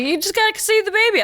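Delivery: high, insistent mock urging; imitated urging. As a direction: pitch it up like pushy friends. Mimicking everyone urging her on.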